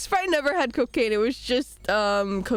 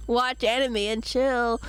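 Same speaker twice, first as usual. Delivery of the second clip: ordinary talk, nothing marked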